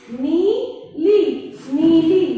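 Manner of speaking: नीली stretched slowly, then नीली said briskly; slow then normal. Shown as a demonstration. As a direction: drag out नीली, then say it whole. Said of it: "इसके हम शब्द भी बता देते हैं".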